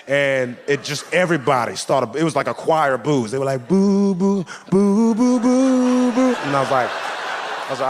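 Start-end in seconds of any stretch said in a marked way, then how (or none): 3.7-6.3 rising, louder mock boo chorus; crowd booing. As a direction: boo him like a whole crowd.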